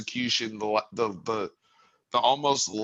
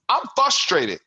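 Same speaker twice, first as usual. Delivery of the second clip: higher and louder, venting frustration; frustrated raised voice; 10 semitones higher, 6 dB louder than usual. Real, not acted. Fan venting to co-host about the loss, raised frustrated voice. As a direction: let the frustration burst out loud. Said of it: "I'm sorry I'm raising my voice".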